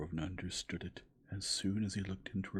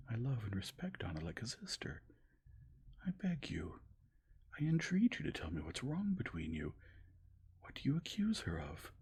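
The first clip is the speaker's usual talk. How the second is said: high, urgent entreaty; pleading entreaty; 8 semitones higher than usual. A narrator's reading. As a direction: beg him to tell her. Dolly entreating him.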